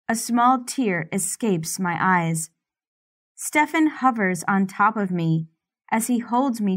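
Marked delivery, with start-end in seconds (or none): none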